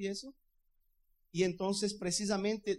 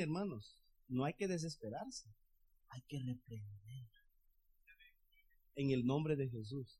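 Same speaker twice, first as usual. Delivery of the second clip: quiet firm advice; 4 semitones lower, 7 dB quieter than usual. Acted. Calm advice after the storm.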